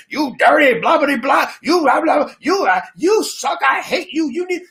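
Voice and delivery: high shouting caricature of the team owner; hammed-up high yelling owner; mock furious owner. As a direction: rant it like an outraged boss. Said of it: "that Cavaliers owner wrote that big long letter".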